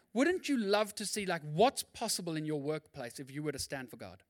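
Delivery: ordinary talk, nothing marked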